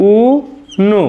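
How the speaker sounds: "উ", pause, then "নো"; syllable by syllable. Shown as a demonstration. Teacher writing out the correct pronunciation, syllable by syllable. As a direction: split "উ নো" into two separate syllables. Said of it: "শুদ্ধ উচ্চারণটি হবে".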